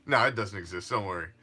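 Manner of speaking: ordinary delivery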